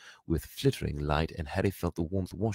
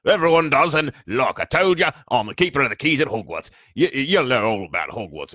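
big, loud, raised giant's voice; hearty giant; 7 semitones higher, 9 dB louder than usual. A narrator's reading. Giant introduces himself to the boy, hearty and loud. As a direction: announce yourself heartily at full volume.

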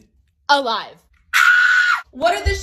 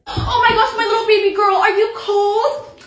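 put-on higher, laughing baby talk; doting mom; 4 semitones higher, 4 dB louder than usual. Mom coos at her shivering daughter, laughing. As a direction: coo over the cold little girl. Mom fussing over cold child.

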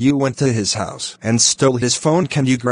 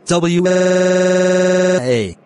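fake crying wail; 7 semitones higher than usual. Arrested kid starts wailing.

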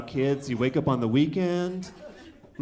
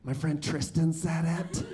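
put-on small, soft, slow child's confession; scared kid; 6 dB quieter, slower than usual. Scared seven-year-old owning up to dad, soft and slow. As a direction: confess timidly in a hushed little voice. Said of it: "He got all scared".

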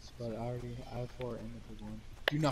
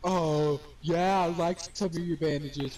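loud; 4 semitones higher, 12 dB louder than usual. Real, not acted. Friend reacts loudly to bandages.

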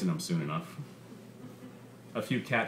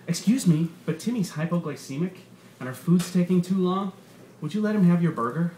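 higher, louder, falsely polite ask; mock entitled mother; 7 semitones higher, 3 dB louder than usual. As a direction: send up a pushy mother. Comic voices entitled mother.

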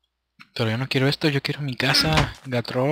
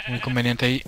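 normal talking voice

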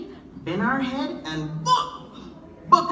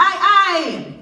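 high, loud call to the tree; 5 semitones higher, 5 dB louder than usual. Acted. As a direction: shout at the figure in the tree. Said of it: "So Anansi cried out".

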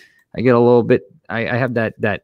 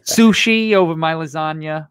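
higher-pitched, indignant Italian grandmother; mock outrage as an offended grandma; scolding grandma; 5 semitones higher than usual. Gram defending her lasagna, mock scolding.

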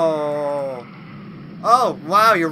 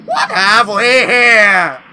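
mock villain voice; 4 semitones higher, 11 dB louder, much slower than usual. Mock villain greeting to monster.

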